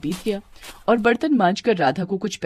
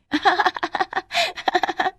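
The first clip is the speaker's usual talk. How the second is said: long, high cackling giggle; acted jeering laugh; mocking laughter; 12 semitones higher than usual. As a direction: laugh at her in high peals. Class laughs at Radha.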